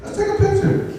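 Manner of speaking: eager high voice agreeing to the photo; high cool reply. Retold or imitated. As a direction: chirp it up high, eager to please. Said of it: "And I'm just like".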